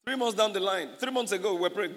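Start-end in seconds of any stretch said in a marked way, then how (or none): none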